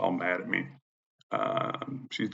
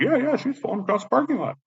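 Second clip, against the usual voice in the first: high-pitched eager chorus of onlookers; imitated witnesses. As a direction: mimic bystanders backing up the story. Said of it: "And they're all like".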